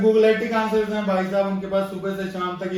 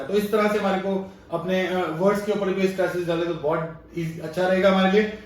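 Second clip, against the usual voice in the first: normal talking voice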